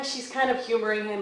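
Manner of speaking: usual speaking voice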